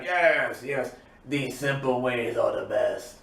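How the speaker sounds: low villain voice, drawn-out opening yes; Aku. Retold or imitated. Villain approves as she pulls out a blade, low imitation. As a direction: imitate a gloating cartoon villain, dropping low. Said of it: "Aku's like".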